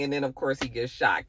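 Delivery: ordinary delivery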